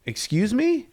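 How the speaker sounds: startled rising Excuse me; taken aback, high-pitched; surprised. Retold or imitated. Confronted by a stranger.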